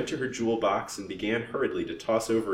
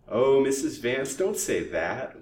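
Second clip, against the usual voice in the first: put-on slow, whining old crone; piteous whine; 6 semitones higher, 5 dB louder, slower than usual. A narrator's reading. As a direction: plead piteously, drawing the words out. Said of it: "whined the old crone piteously".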